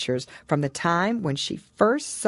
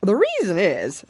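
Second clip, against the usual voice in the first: louder, brisk Gryphon voice; a loud creature taking over the story; 5 semitones higher, 6 dB louder than usual. A narrator's reading.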